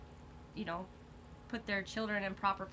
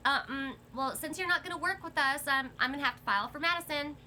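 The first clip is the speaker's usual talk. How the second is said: higher, snooty and haughty; 6 semitones higher, 3 dB louder than usual. Retold or imitated. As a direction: look down your nose at them. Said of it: "in a snooty voice".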